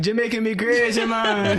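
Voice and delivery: put-on playful Jamaican accent, tipping into laughter; mock Jamaican accent. Friend teasing the traveler back from Jamaica, mock Jamaican accent. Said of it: "Jamal was out there in Jamaica".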